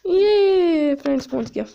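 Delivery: high, slow, loud delight. Real, not acted. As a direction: sing out that he finally came. Dog arrives.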